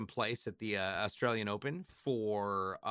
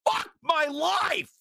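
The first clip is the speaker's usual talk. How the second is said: drawn-out exasperated shout; 7 dB louder, much slower than usual. Acted. Punchline, drawn out.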